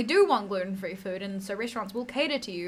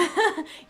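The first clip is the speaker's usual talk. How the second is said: a quick real laugh, high and loud; short burst of laughter; 9 semitones higher, 5 dB louder than usual. Real, not acted. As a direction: laugh out at the punchline. Laughs at own story.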